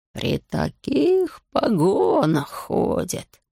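very slow, stretched-out words; grave, impressive tone. A narrator's reading. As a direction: draw every word out for menacing effect. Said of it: "понизил голос". (внушительно, очень медленно)

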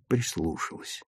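ordinary delivery, nothing marked

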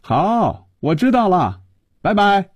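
high-pitched, stretched-out syllables; long-drawn, high phone goodbye; drawn-out reply. A narrator's reading. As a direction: drag out each word in a high voice. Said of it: "Lucy拖着长衣说".

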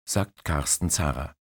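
ordinary delivery

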